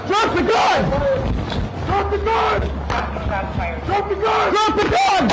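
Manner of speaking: urgent shouting. Real, not acted. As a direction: yell it with everything you've got. Officer faces armed suspect.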